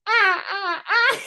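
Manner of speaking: very high squealing ah ah; imitating high squeals. Cousin bouncing on the waves, squealing and laughing. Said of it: "he's also going".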